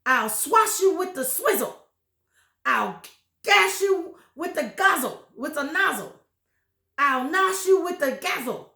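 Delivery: high, loud witchy threat; furious shout. A narrator's reading. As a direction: screech the threat down from the sky. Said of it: "she shouted".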